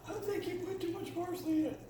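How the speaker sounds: high, silly put-on voice; mock family voice. To her family, mocking their parsley complaint in a high silly voice. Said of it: "they didn't sound like that".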